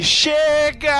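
fed-up yell. Retold or imitated. Yelling to stop the ride.

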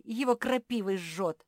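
pained, high outcry; howling. A narrator's reading. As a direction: wail about the sting. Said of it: "взвыл Банди".

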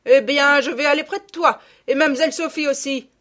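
high, loud, brisk retort; no-nonsense country mother shouting; stern shouted reply. A narrator's reading. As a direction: call back firmly as the annoyed mother. Mother answers threateningly.

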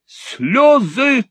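high, loud exclamation; crying out. A narrator's reading. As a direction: cry it out, high and sudden. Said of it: "вскричал старый джентльмен".

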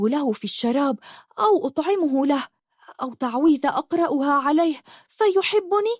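high-pitched, halting, embarrassed plea; flustered. A narrator's reading. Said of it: "قالت بارتباك". (بارتباك وتلعثم، بصوت مرتفع الطبقة)

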